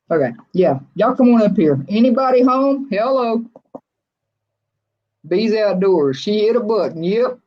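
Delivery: ordinary talk, nothing marked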